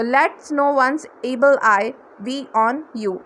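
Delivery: every word drawn out slowly; slow demo phrase. Shown as a demonstration. Teacher slowly models the blessing for learners, drawn out. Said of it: "है ना?".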